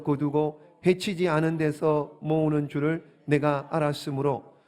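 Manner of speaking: low, slow, measured scripture reading. Acted. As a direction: read the verse out solemnly in deliberate phrases. Reads the scripture aloud. (낮고 느린 성경 봉독)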